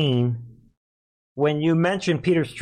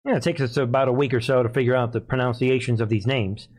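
ordinary talk, nothing marked

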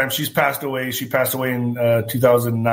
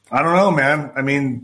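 ordinary delivery

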